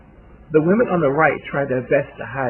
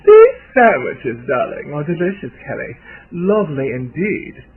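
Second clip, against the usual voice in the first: faux British accent; 2 semitones higher, 9 dB louder than usual. A narrator's reading. Older socialite praising her hostess's sandwiches, posh faux British accent. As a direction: put on a posh, nasal British society voice. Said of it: "speaks her usual nasal, posh, faux British accent".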